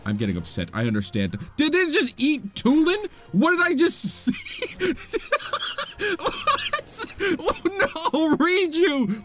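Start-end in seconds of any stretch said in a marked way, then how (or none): 1.6-4.8 high-pitched, laughing disbelief; shocked disbelief. Real, not acted. Gamer shocked as the monster eats his companion, high laughing disbelief. 4.8-7.3 high-pitched helpless laugh; shocked laughter. Real, not acted. Gamer watching the monster eat Tulin, high helpless laughter. 7.4-9.2 high laughing panic; panicked laughing. Real, not acted. Gamer seeing the demon grab Riju, high laughing panic. Monster grabs companion.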